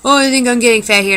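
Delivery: high, loud, fast mock of her sister's complaint. Vlogger mimicking her sister complaining about weight, high and loud. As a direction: squeal it fast like a fussy sister. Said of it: "she said".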